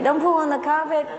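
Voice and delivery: high, sharp scold of a woman; owner's scolding voice. Retold or imitated. Parrot mimicking the owner, high scolding voice. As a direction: scold like the owner at her pet. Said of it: "sounds exactly like the owner's voice".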